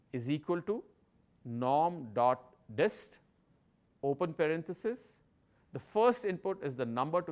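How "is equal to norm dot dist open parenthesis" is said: dictating. Shown as a demonstration. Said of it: "The syntax of the function is".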